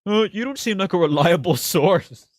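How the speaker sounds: hammed-up imitation of a pompous commenter; mocking a commenter. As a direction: sneer his line back at him, laughing. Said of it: "And then he goes".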